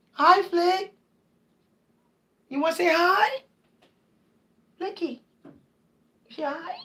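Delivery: high coo, fading to a near whisper; soft high pet voice. Real, not acted. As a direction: coax the cat sweetly. Cooing to another cat.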